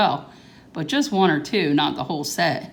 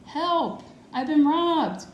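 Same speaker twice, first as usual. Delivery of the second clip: high, alarmed cry for help; storybook shout; 9 semitones higher, slower than usual. Acted. Grandpa calls the family upstairs, high alarmed shout. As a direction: call out like Grandpa just found he was robbed. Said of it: "we heard Grandpa shouting".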